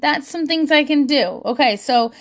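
ordinary talk

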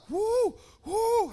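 two high-pitched whoops; high, happy "whoo" hooted twice; excited whoop. Retold or imitated. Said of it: "part of me's like".

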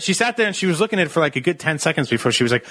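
ordinary talk

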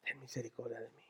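very soft, low, near-whispered prayer; hushed plea. Acted. Preacher echoing Bartimeo's cry for mercy, hushed plea. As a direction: beg under your breath. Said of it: "Bartimeo que grita".

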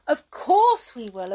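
loud eager promise; eager cry. Acted. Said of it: "cried the brownies".

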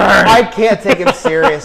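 emphatic. Real, not acted. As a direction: exaggerate the disbelief. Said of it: "I'm here going".

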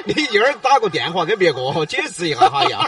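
amused, laughter running through it; laughing while talking. Real, not acted. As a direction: keep laughing as you speak. Host laughing after failed prank.

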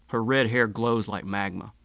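usual speaking voice

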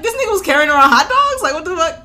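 high, loud and fast disbelief; incredulous outburst. Retold or imitated. Host replaying her shocked reaction, loud, high and fast. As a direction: blurt it out like it's absurd. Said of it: "But I'm like".

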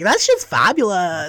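slow, sassy, drawn-out fabulous; flamboyant. Shown as a demonstration. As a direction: camp it up on fabulous.

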